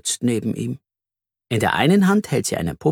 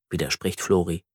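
ordinary delivery

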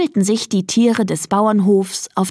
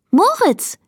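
high, delighted-style name call; 14 semitones higher than usual. A narrator's reading. Girl Elisa greets her cat at the window, calling out. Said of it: "rief sie".

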